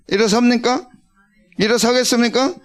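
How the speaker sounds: normal talking voice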